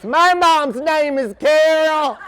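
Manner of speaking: high, very slow drawn-out call; random audience voice. Retold or imitated. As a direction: mimic a heckler calling from the dark. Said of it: "some random voice in the darkness".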